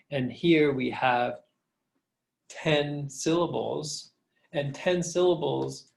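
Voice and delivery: normal talking voice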